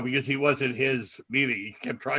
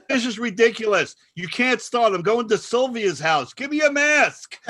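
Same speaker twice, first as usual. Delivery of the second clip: high, loud mock indignation; hammed-up exasperated outburst; exasperated complaining; 8 semitones higher, 5 dB louder than usual. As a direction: complain loudly like a fed-up old woman. Retold woman complaining in the meeting.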